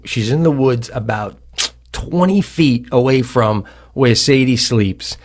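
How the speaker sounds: ordinary talk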